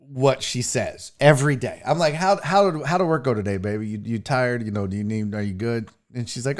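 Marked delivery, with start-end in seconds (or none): 2.1-5.9 soft, low, sweet-talking husband voice; mock tender husband. Husband sweetly asks his tired wife about work, soft and low. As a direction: coo the questions to your wife.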